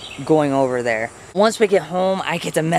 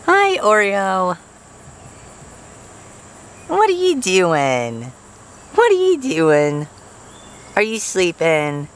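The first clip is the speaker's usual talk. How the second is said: pet talk; 3 semitones higher, slower than usual. Real, not acted. Owner cooing slow baby talk to the sleepy cat. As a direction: coo at the pet, drawn out.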